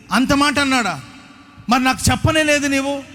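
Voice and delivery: shocked she was never told; indignant sister. Retold or imitated. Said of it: "గట్టిగా లేచి ఇలా అంటది".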